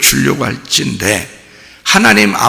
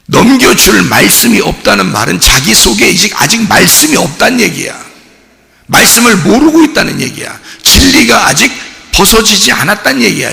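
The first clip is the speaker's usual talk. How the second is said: rising, louder preaching that builds to a shout; fiery preaching; 8 semitones higher, 5 dB louder than usual. Acted. Pastor pressing the congregation, building to a shout. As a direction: hammer the point home to the congregation.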